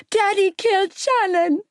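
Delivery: put-on high, horrified little-boy cry; Ollie screaming. A narrator's reading. Little boy telling what his father did, screaming. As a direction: wail it as a small child, without full volume. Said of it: "Ollie was screaming from the hallway".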